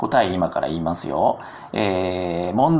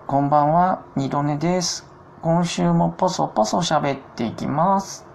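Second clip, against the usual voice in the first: put-on higher voice for another host's opening; 二度寝さん impression; 7 semitones higher than usual. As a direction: imitate a radio host's gentle sign-on, pitched up. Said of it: "二度寝ラジオの二度寝さんです。".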